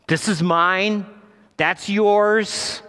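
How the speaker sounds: high, loud back-seat sibling claim; squabbling child. Acted. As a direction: stake your territory like a quarrelling kid. Acting out back-seat sibling quarrel.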